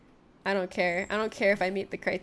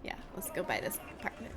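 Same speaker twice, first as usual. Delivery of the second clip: whispered aside; 3 semitones lower, 10 dB quieter than usual. Real, not acted. Deciding while browsing apartments.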